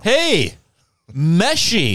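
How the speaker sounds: high, loud call; mock hearty greeting. Customer calling out to store owner, mock hearty. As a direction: hail him like an old pal.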